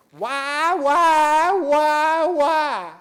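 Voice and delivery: high, loud Southern preacher's cry of why. Retold or imitated. Southern preacher crying out to his church, loud high. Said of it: "like a fellow I heard preach".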